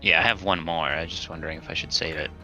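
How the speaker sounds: usual speaking voice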